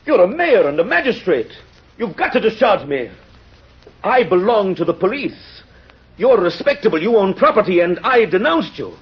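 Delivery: pitched high and insistent, refusing forgiveness; indignant outburst. Acted. Javert to the mayor, refusing his forgiveness, indignant and high. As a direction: rage at being forgiven, voice climbing. Inspector demands the mayor punish him.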